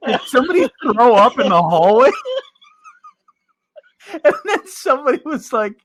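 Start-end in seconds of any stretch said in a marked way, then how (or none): 0.1-2.1 high, loud, laughing question; buddy's high loud question, laughing. Retold or imitated. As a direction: shout the question through laughter. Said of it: "he goes".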